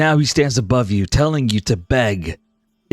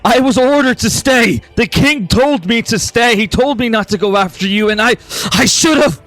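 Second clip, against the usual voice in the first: higher, louder, breaking with emotion toward shouts; anguished plea; 9 semitones higher, 5 dB louder than usual. Acted. Blue Rose to his lost daughter Lyra: rising, anguished apology. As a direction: pour out regret until it cracks into shouting.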